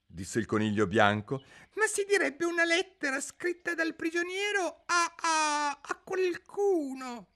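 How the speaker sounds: very high, squeaky fussy rabbit; high-pitched rabbit herald in court. A narrator's reading. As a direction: chirp like a nervous little rabbit.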